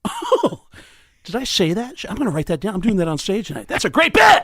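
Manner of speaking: high "oh" building to an excited yell; light up with sudden delight, high-pitched; Sam impression. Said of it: "Sam just looked at him and went".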